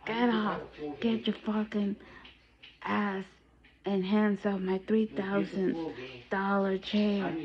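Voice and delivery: lightly voiced furious old man; retold angry order. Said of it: "he told me to get off".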